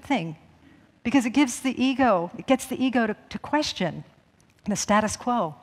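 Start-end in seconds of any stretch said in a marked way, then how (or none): none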